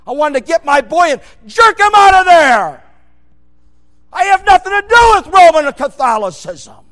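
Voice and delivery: loud, high full-force sermon shouting; fiery preaching. Acted. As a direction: thunder it from the pulpit.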